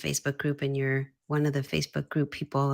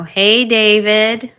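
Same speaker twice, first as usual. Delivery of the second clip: loud, drawn-out, higher-pitched hello; enthusiastic greeting; 5 semitones higher, 13 dB louder, much slower than usual. Real, not acted. Host welcomes David into the live stream, enthusiastic greeting. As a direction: greet them big, stretching the words.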